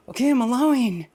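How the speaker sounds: loud, high, hurried claim of letting go; hammed-up insistent outburst; mock forced allowing. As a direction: blurt it out, acting over-eager to be done. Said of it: "what happens is that people go".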